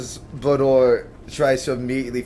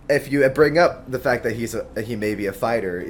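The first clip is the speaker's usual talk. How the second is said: ordinary delivery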